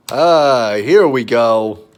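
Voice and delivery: ordinary talk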